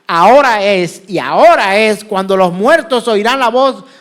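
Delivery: loud, emphatic pulpit declamation; scripture proclamation. Acted. Preacher quotes Christ's promise to congregation, loudly proclaimed. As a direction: drive each phrase home forcefully.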